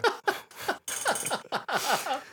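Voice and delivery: high burst of laughter. Real, not acted. Laughing at the machine.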